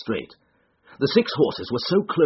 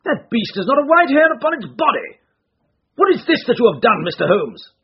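high, loud indignant accusation; sudden loud outburst; 12 semitones higher, 6 dB louder than usual. A narrator's reading. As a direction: demand an answer angrily. Owner accuses Holmes angrily.

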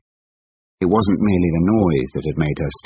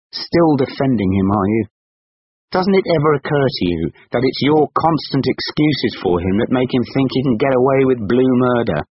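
higher, indignant questioning; building exasperation, pitched up; angry accusation; 7 semitones higher, 4 dB louder than usual. A narrator's reading. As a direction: demand an answer in rising anger. Angry husband accuses wife in argument.

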